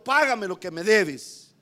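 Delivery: a touch of the angry creditor; demanding servant. Acted. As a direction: lean in as the choking creditor. Choking servant demands payment.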